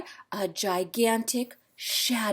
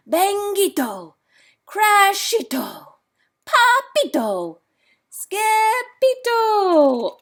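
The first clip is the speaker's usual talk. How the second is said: loud calling out; 10 semitones higher, 6 dB louder, slower than usual. A narrator's reading. Noisy ruckus from room.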